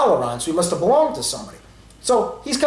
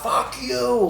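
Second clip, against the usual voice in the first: a mild imitation of a yelled insult from a car; lightly imitated yell out the window; mock yell; 6 semitones higher, 3 dB quieter than usual. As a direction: toss off a half-hearted retold shout. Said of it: "yelled out".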